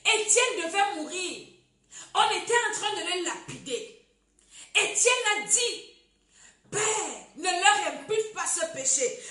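loud, high-pitched fervent sermon; preaching at full force. Acted. (prédication enflammée, voix forte)

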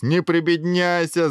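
high, loud, drawn-out acted mirth; gleeful hilarity. A narrator's reading. Guard brushing off the prince's modesty, gleeful. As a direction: crow it as if helpless with laughter. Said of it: "заливался стражник".

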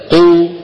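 قو with a bouncing qaf; قو with a loud echoing qaf; qalqala on qaf. Shown as a demonstration. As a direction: say قو loudly, the qaf springing open. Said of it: "قولوا مثلي لو سمحتم".

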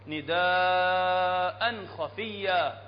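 نداء with its long ا stretched very slowly; held madd. Shown as a demonstration. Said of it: "مد متصل". (مدّ متصل طويل في نداء)

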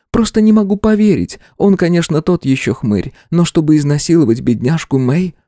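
high female voice in disbelief; shocked woman voice. A narrator's reading. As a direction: voice her, stunned and upset.

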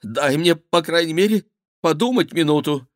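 high-pitched urgent plea; pleading. A narrator's reading. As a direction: beg for time in a panicky voice. Cornered king begs for time.

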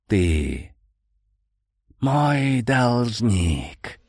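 slow hissing ghostly whisper; whistling ghost voice. Acted. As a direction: drag it out in a whistling hiss. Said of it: "произнёс свистящим голосом призрак".

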